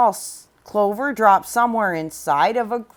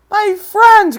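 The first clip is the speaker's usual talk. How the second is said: much higher, louder relieved cry; joyful cry; 11 semitones higher, 10 dB louder than usual. Acted. Horton finding his friends at last, loud joyful cry. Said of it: "cried the elephant".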